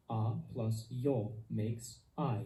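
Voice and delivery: A plus yo makes I, drawn out slowly with I gliding; teacher modelling slowly for learners, clear and drawn out; slow diphthong demo. Shown as a demonstration. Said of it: "Diphthongs are an important part of Esperanto pronunciation".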